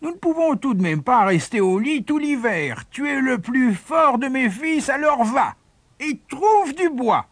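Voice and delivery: high, insistent grumbling mother; scolding mother. A narrator's reading. Mother sending her strongest son out for wood, scolding. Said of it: "gronda la mère".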